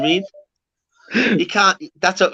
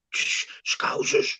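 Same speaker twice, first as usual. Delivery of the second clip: mock whisper, drawn out slowly; slow, voiceless hush into a walkie-talkie; hushed alert; 6 dB quieter, much slower than usual. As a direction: imitate a guard whispering a warning. Guards alert each other on walkie.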